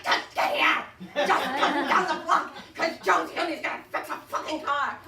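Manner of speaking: loud, hammed-up frantic scream; comic screamed demand. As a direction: yell desperately at a spouse. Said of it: "but I'm screaming at him".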